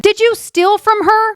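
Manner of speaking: high-pitched accusing imitation; mock mad. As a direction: act out his indignant questioning. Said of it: "he's, he's really mad".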